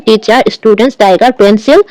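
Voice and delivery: ordinary delivery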